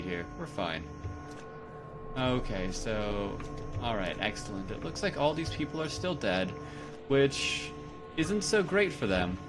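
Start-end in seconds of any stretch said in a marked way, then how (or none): none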